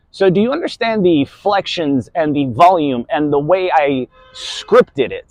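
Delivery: ordinary delivery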